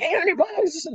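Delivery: very high-pitched, disbelieving outburst; astonished kid. Retold or imitated. Son hearing what the first day pays, high-pitched amazement. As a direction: squeal the amount in amazement.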